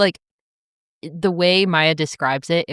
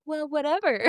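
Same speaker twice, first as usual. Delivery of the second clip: dismissive quote; 8 semitones higher, 6 dB quieter than usual. Retold or imitated. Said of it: "And she's like".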